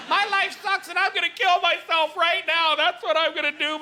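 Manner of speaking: high, dragged-out melodramatic despair; mock despair. Comic acting out suicidal procrastinator, mock despairing wail.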